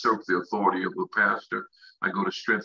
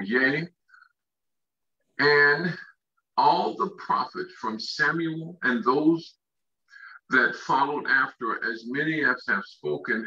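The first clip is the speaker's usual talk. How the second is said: slow, higher, stretched scripture reading; 4 semitones higher, slower than usual. Real, not acted. Preacher reading Acts to his congregation, slow proclaiming scripture reading. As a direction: proclaim the verse, pausing between phrases.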